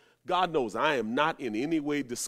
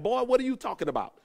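scornful retort; 5 semitones higher, faster than usual. Retold or imitated. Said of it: "You know, like".

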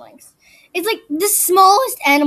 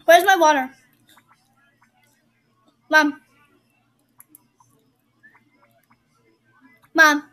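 yelling across the room; 2 semitones higher, 6 dB louder, slower than usual. Real, not acted. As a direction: call out loud to another room. Calling to mom in another room.

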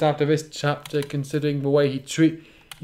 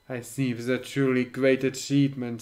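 low, soft, menacing emperor voice; evil emperor; 4 semitones lower, 6 dB quieter than usual. Acted. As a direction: speak low like a dark overlord. Emperor speaks in story.

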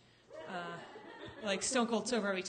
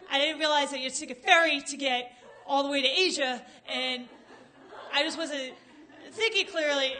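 loud, higher, frantic over-explaining; panicked babbling; 6 semitones higher, 5 dB louder than usual. Retold or imitated. Stoned-looking driver pleading with the cop, loud panicked babble. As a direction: blurt out a nervous defense. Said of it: "and I'm like".

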